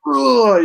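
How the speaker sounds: hammed-up groan of revulsion; disgusted groan. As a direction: imitate a grossed-out reaction. Imitates characters' disgusted reaction.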